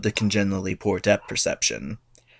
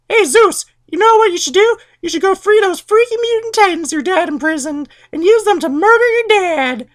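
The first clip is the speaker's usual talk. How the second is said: very high, louder falsetto urging; Rhea scheming; 5 dB louder than usual. Retold or imitated. Rhea urging her son Zeus, high falsetto. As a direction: coax sweetly toward murder. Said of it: "So Rhea's like".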